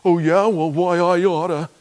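preacher mocking his own angry reaction to the government; mock angry comeback. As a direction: play a hothead itching for a fight. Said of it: "I get mad and agitated".

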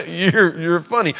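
short disbelieving laugh into the words; incredulous laugh. Acted. God scoffing at those who defy him, incredulous laugh. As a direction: laugh as if you can't believe it. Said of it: "it's like this incredulous laugh".

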